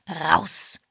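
short, loud hissed command; angry hiss. A narrator's reading. Husband holding the door wide for visitors, hissing. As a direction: throw the word at them like a hiss. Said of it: "zischte Mister Parr".

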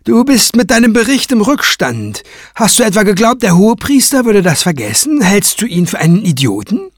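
high-pitched derisive accusation; sneering. A narrator's reading. Craven berating Kranz over his late report, sneering. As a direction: scold with open scorn. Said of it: "fragte Craven höhnisch".

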